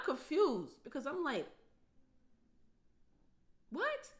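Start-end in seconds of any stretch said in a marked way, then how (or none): 3.7-4.1 very high squeak of disbelief; stunned what. Retold or imitated. Daughter confused by ungrateful father, stunned high what. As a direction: shoot up in total confusion.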